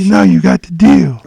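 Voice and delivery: loud, lightly voiced eerie catchphrase; a hint of the creepy patient voice; imitating the patient. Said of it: "That was his shit".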